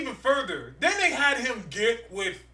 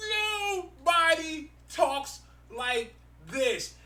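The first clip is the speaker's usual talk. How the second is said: high, softer, drawn out word by word; slow, exasperated emphasis; 6 semitones higher, 5 dB quieter, much slower than usual. Real, not acted. Driving home that the comic dialogue is awful, slow exasperation. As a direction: space out every word in exasperation.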